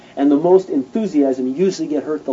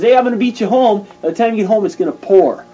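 higher, louder taunt while running; excited boast; 6 semitones higher, 5 dB louder than usual. Acted. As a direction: brag to the king, full of energy. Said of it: "he runs up to Ahab and he says".

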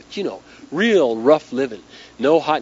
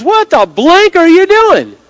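high, loud, drawn-out mock outrage; outraged yell; 12 dB louder, slower than usual. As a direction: yell it in disbelief, stretching every word. Acts out her outrage at Leakey.

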